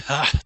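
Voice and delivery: loud mocking bark of a laugh; scornful laugh. Raider chief laughing at the would-be raiders, loud scornful laugh. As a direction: laugh them off.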